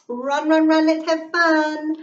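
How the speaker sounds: high, loud sing-song call; excited chant to race. Acted. Child calling out to animal.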